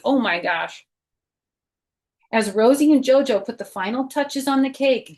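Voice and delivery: usual speaking voice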